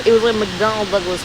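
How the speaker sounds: usual speaking voice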